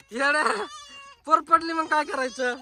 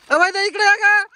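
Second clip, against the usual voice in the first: called out loud and higher to someone across the yard; calling out; 5 semitones higher, 5 dB louder than usual. Real, not acted. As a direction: raise your voice to fetch her over. Calling someone over from afar.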